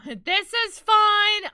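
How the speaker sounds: loud, high, drawn-out mock cheer; mock everything's fine. Streamer feels the room is on fire, loud mock calm. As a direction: belt it out slowly as if all is burning.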